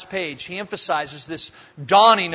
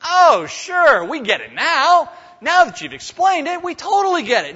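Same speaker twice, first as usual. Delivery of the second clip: higher, breezy put-on eagerness; mock eager agreement; 7 semitones higher than usual. Disciples boasting to Jesus, a mock eager higher voice. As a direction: play the disciples' smug "we get it". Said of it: "what are they playing like".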